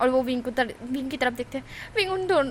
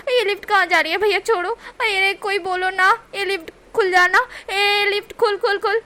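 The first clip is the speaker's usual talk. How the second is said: high, loud panicky shouting; panicked yelling; 7 semitones higher, 6 dB louder than usual. Acted. Wing trapped in lift, loud fake panic. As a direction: bang on the lift and yell. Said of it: "wing चिल्ला रहा था".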